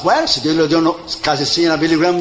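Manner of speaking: ordinary talk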